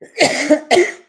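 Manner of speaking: hard choking cough. Real, not acted. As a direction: cough it down the wrong pipe. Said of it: "I'm really choking".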